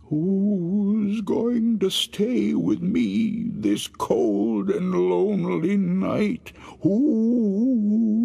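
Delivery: high, slow, drawn-out ghostly moan. Acted. Dead husband calling to his wife, high slow ghostly moan. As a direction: wail it out like a lonely ghost.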